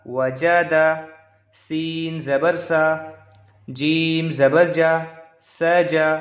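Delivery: slow, measured letter-by-letter recitation; Qaida-style drill, evenly paced; spelling drill recitation. Shown as a demonstration. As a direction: draw out each letter name, then join the syllables.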